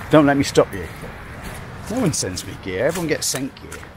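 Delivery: ordinary talk, nothing marked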